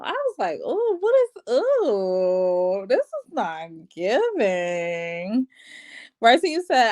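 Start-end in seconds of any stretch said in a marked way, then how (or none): 0.6-5.5 drawn-out, dismayed ooh, dragging to a slow finish; retold dismay. Reacting to the dude's traditional views, drawn-out dismay.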